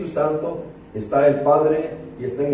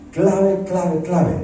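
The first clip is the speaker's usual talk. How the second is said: preacher drives home the key word clave, clave, clave; repeated emphatic clave; 3 semitones higher, much slower than usual. Real, not acted. To the brothers, stressing clave each time. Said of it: "ese asunto es clave".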